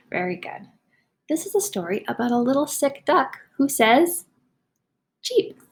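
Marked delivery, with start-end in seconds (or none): 1.3-5.6 slow, expressive storybook reading; slow picture-book read-aloud; reading to children. Acted. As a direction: read it slowly for young listeners. Teacher reads story to children.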